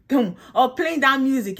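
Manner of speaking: ordinary talk, nothing marked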